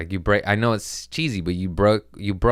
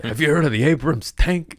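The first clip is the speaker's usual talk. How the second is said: dumb guy voice; 8 semitones higher, 5 dB louder than usual. Retold or imitated. Dumb guy quoting holy book.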